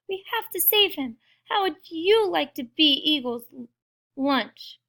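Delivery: high-pitched indignant appeal; urgent appeal. Acted. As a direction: challenge the crowd, high at first. Pip argues for a rescue.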